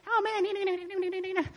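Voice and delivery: high, mocking whiny moan; complaining divorced women. Divorced women griping about life in small group, high mock complaint. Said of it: "they're unfortunately complaining about life".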